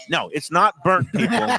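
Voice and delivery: high, laughing no it's not burnt; playfully insist, laughing; laughing protest. Real, not acted. Correcting people who call pizza burnt.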